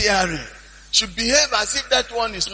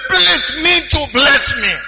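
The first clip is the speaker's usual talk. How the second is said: loud, high, slow mock wail; acted wailing appeal; imitated crying plea; 4 semitones higher, 5 dB louder, slower than usual. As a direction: beg at the top of the voice, as if crying. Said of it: "he cried".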